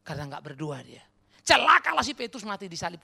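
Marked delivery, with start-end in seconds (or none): 1.4-3.0 loud, high sarcastic pronouncement; mock condemnation. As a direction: hurl a mock curse.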